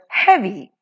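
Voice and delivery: "heavy" with HEA stressed; stressed "hea", light "vy" in "heavy"; stress on first syllable. Shown as a demonstration. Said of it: "the first syllable he is stressed".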